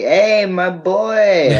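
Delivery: high, slow hearty greeting; drawn-out greeting. Retold or imitated. Jack welcoming him at the party, slow. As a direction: stretch it out, delighted. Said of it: "Jack's like".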